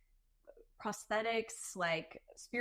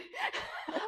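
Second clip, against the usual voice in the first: high laughter. Real, not acted. Guest laughing at the celebrity's small talk, high laughter.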